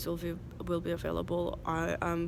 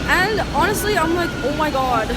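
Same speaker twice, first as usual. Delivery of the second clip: high, loud and worked up; really freaking out, voice climbing; agitated; 6 semitones higher, 9 dB louder than usual. Real, not acted. Ranting to the camera about disease, loud and high.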